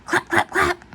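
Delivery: breathy whispered clap clap clap; clapping sound. Acted. Noise of clapping in story.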